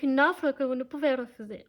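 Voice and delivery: badly faked Italian mobster accent; accent italien pourri du Parrain. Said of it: "mon accent, il, il est tout pourri". (léger accent italien mal imité)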